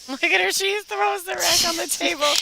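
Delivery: high, loud delighted cheer; excited cheering. Real, not acted. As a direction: cheer her on. Cheering a player's shot.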